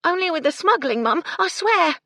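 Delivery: high, loud, pleading maid; earnest servant girl insisting. A narrator's reading. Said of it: "Gertie said earnestly".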